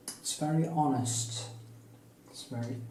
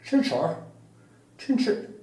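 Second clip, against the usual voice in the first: mock Chinese accent; 8 semitones higher, 3 dB louder than usual. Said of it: "Chinese can't really say K".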